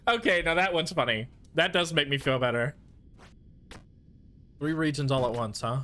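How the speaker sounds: ordinary talk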